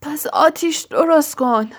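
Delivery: almost out of breath, urgent; breathless. A narrator's reading. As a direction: gasp the order out, nearly choked. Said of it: "نزدیک بود نفسش بند بیاید".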